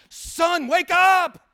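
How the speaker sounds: high, loud shout; shouting at Jonah. Acted. Fed-up father yelling at his son to wake up. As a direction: shake him awake with your voice.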